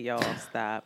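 low, soft and tired; weary low plea. Retold or imitated. Host quietly begging the feuding openers to stop. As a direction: beg them low under the breath. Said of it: "I said".

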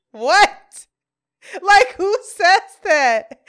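high, loud incredulous outburst; loud disbelief. Retold or imitated. Reacting to a friend's odd denial, loudly incredulous. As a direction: blurt it out in amused bafflement. Teller's own baffled reaction retold.

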